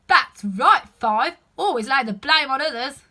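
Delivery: loud sarcastic jab; sarcastic retort. Acted. Seven snipes at Five, loud and sarcastic. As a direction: throw the blame back mockingly. Gardeners bickering.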